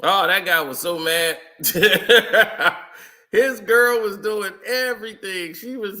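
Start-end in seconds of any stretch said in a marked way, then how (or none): 1.6-2.8 loud burst of laughter; real amused cackle, out loud. Real, not acted. Laughs at angry guy story.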